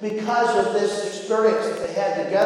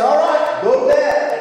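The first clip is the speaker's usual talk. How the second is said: loud, raised-pitch send-off to bed; dad's bedtime order; 6 semitones higher, 4 dB louder than usual. Retold or imitated. Dad to son at lights out, brisk loud order. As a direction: voice a dad wrapping up bedtime, higher and louder.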